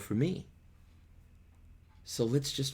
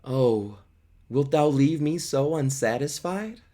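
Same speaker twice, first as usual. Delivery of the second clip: put-on teenage boy; 4 semitones higher, 4 dB louder than usual. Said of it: "Romeo interrupts in typical teenage boy".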